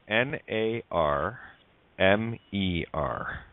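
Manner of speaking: N A R M E R spelled letter by letter, slowly; slow spelling. Shown as a demonstration. Host spelling Narmer for everyone, very slowly. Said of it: "go through Narmer very slowly".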